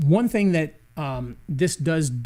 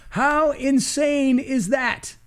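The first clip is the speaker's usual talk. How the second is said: amazed; 9 semitones higher, 3 dB louder, much slower than usual. Real, not acted. Amazed at a translation feature.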